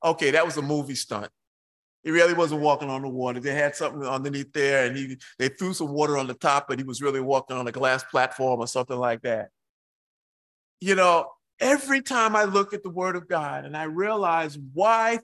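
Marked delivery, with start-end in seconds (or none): 0.0-9.5 scoffing skeptic. Pretend. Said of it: "we would all believe".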